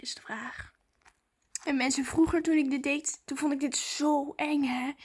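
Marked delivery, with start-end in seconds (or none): 0.0-0.8 trailing hushed aside; whisper. Real, not acted. Vlogger whispering while waiting for a reply. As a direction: let it fade into a whisper.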